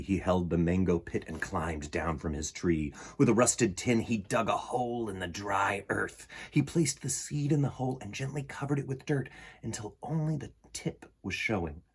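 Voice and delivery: usual speaking voice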